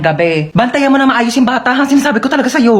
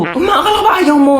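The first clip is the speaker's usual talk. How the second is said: surprised outburst; 7 semitones higher, faster than usual. Acted. Daughter surprised by mother.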